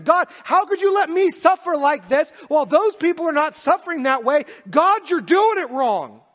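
a hint of David railing at God, loud and high; mock-voiced psalmist complaining; voicing David's complaint. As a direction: cry out David's protest, slowing at the end. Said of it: "He says".

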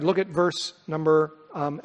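ordinary delivery

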